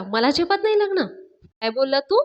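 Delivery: high, indignant questioning; retold outrage, pitch climbing on the question; affronted challenge. Raja rushes at Panya over the marriage jibe, affronted.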